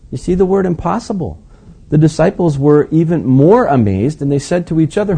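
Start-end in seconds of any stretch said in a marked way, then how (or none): none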